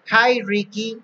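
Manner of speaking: a hint of a cheery storybook hello; Sammy's greeting. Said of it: "says Sammy".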